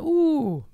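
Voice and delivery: very high mock squeal of disgust; hammed-up high ooh of disgust. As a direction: squeak it like you just squeezed poop. Finding poop at beach.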